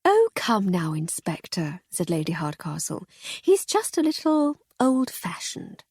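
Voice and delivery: Lady Hardcastle gently teasing the inspector; lady's amused reply. Acted. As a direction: soothe him with an amused lilt.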